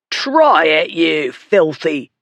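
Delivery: slow, drawn-out bully's dare; a bully's angry challenge. A narrator's reading. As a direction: goad him into a fight. Bully cursing his opponent.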